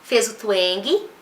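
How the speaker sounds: ordinary talk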